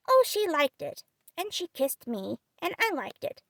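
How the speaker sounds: put-on high, happy small boy; lightly voiced little boy. Child tells of kiss.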